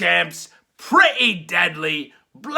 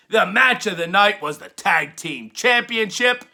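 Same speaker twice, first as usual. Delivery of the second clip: ordinary delivery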